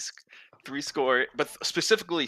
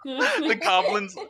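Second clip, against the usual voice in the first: slow, laughing through the words; 10 semitones higher, 3 dB louder, much slower than usual. Real, not acted. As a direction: say it while cracking up. Laughing at joke.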